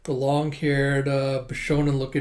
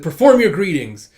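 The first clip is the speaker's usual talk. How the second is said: loud, raised order; drill command; 3 semitones higher, 10 dB louder than usual. Retold or imitated. Senior orders junior to greet, loud command. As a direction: call it out as an instruction.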